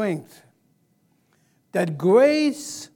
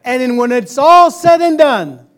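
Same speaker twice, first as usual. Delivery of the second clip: preaching at full force; 4 semitones higher, 9 dB louder than usual. Acted. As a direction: proclaim it over the church. Call and response.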